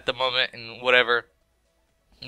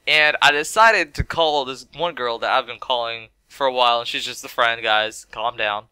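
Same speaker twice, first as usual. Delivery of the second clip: ordinary talk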